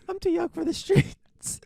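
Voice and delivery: put-on very high, slow kid voice; nine-year-old action hero. Co-host as the child star, slow squeaky kid voice. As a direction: draw it out in a tiny squeak. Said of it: "Little nine-year-old".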